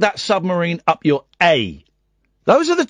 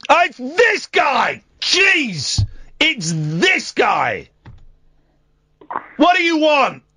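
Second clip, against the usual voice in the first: exasperated shout; 8 semitones higher, slower than usual. Real, not acted. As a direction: groan it out in exasperation. Host mock-exasperated at caller.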